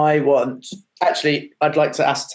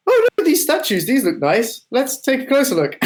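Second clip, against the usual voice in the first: very high, playful falsetto; put-on admiring voice; 11 semitones higher than usual. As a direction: coo over it in a much higher pretend voice. Player puts on a sightseeing voice.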